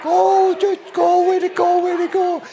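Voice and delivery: high, loud gushing to a retrieving dog; excited dog praise. Retold or imitated. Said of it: "I'm really excited".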